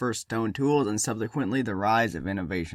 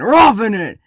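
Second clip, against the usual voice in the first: high, loud emphatic burst; emphatic shout; 10 semitones higher, 13 dB louder, slower than usual. Acted. Narrator stressing the ancestors' hard life, emphatic shout. Contrasting real roughing it with camping.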